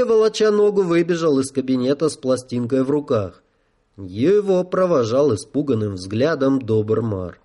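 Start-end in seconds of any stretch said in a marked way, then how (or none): none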